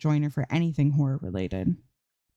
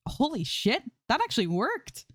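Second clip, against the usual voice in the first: excited shout; 9 semitones higher, 3 dB quieter than usual. Acted. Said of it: "Zoe shouts".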